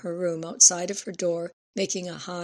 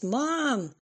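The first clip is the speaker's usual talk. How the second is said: calling out; 7 semitones higher than usual. A narrator's reading. Girl calls out from car.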